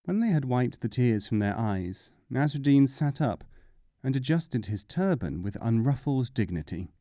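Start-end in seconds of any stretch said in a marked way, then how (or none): none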